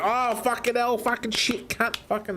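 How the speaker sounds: high-pitched retold cursing; retold anger. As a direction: act out an angry swearing fit. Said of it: "smacked my face a few times in anger".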